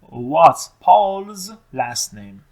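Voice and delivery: very slow, stretched question; slow model question. Shown as a demonstration. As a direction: drag each word out for learners. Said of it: "حتماً پرسیده". (پرسش کشیده و خیلی آهسته)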